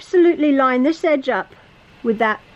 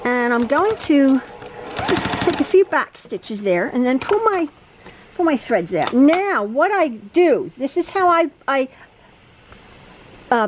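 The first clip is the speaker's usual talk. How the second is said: ordinary talk, nothing marked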